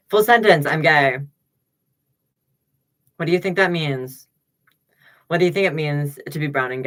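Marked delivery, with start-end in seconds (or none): 0.1-1.2 emphatic declaration. Real, not acted. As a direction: announce it proudly and loud. Declaring loudly to audience.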